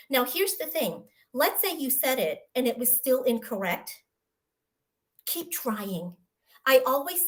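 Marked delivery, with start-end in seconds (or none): none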